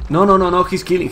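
ordinary talk, nothing marked

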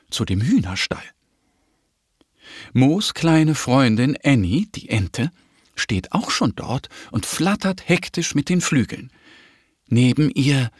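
usual speaking voice

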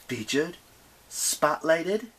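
mildly indignant rising question; affronted goddess. Acted. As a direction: echo the word with a touch of offence. Offended goddess echoes the insult.

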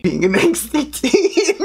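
high, drawn-out words through a laugh. Pretend. Joking to friend about being an angsty teen, laughing. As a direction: say it slowly, breaking into giggles. Mock answer, laughing along.

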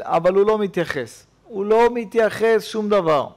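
normal talking voice

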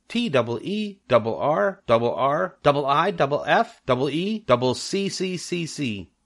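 high goose voice, each letter doubled; a silly goose rattling off letters to the spider; stuttering goose. Acted. Barnyard goose spelling out letters to Charlotte, high and doubled.

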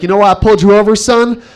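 lightly voiced small-town cop; a hint of an officer at the car window. Cop to pulled-over comedian, mock official. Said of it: "cop came up to our window".

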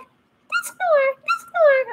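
very high squeaky Pokemon cry; squeaky creature voice. Shown as a demonstration. Imagining how Dusknoir sounds, very high and squeaky. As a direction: squeak the name like a tiny creature. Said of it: "high-pitched, squeaky voice".

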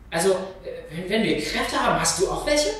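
höher und lauter, überstürzt fragend; aufgeregte Neugier. Acted. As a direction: sprudelt vor Neugier. Aufgeregte Schwester fragt die Mutter. (Tochter fragt die Mutter aufgeregt, höher und lauter)

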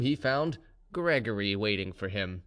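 plain reading voice

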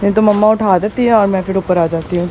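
ordinary talk, nothing marked

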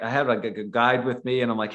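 ordinary talk, nothing marked

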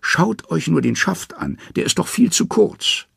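very high, anxious trickster voice; frightened high Loki voice; nervous Loki. A narrator's reading. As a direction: Loki squeaking up in fear. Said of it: "Loki hat es mit der Angst bekommen".

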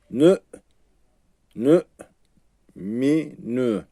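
Nü and mi cut off short, not lengthened; short clipped syllables. Shown as a demonstration. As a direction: cut Nü off like snipping a thread. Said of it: "Uzatmıyorum".

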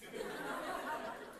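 soft airless burst of laughter; quiet breathy chuckle. Real, not acted. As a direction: laugh under the breath, amused. Laughing at the farmer's reply.

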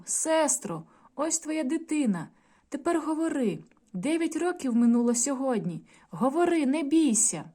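lightly voiced brother begging his sister to speak; pleading brother. A narrator's reading. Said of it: "вигукнув".

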